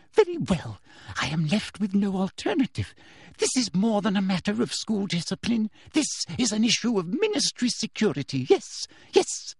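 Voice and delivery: high, agitated self-persuasion; talking herself into it. A narrator's reading. Teacher justifying harsh measures to herself, agitated. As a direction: convince yourself aloud, pitched well up. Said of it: "seemed to be talking herself into something".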